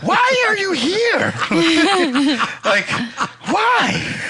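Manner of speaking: shrill high mock outrage; squeaky high voice. Mock indignation at cohost.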